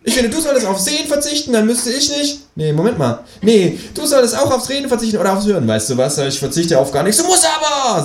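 high kangaroo voice, tripping over itself; squabbling kangaroo. Acted. Kangaroo bickering with the narrator, high and stubborn. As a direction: argue it out, muddled and stubborn.

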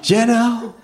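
hoher, knapper Figurenruf; hoch zustimmen; Känguru. Acted. Känguru stimmt zu. (hohe Känguru-Stimme)

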